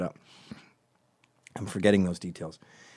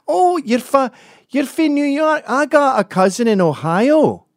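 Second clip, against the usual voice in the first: loud, high-pitched mock Scot; Scottish accent; 8 dB louder than usual. Local telling a visitor of a cousin in Ohio, mock Scottish accent. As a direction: pipe up loudly as a chatty caricature Scot. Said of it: "they always say the same thing".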